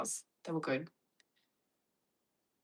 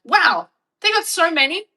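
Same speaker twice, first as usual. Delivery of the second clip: mock-amazed gush, much higher and louder; amazed wow; 11 semitones higher, 11 dB louder than usual. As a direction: play your wide-eyed newcomer self. Amazed quote of her past self.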